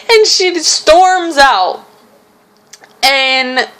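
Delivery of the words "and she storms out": higher, words through laughter; a real laugh running through the line; laughing while talking. Real, not acted. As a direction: tell it while still giggling.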